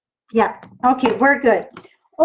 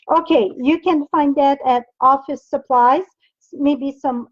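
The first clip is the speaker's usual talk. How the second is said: normal talking voice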